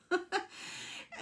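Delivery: breathy high giggle at herself; real laugh. Real, not acted. Speaker laughing at her own little voice. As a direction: laugh off the silly voice.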